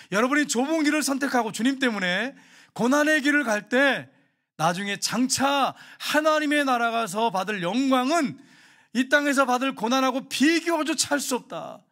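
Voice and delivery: loud and high, slowing on the promise of glory; fervent preaching. Acted. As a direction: proclaim it to the congregation with full force. Preaching at full force.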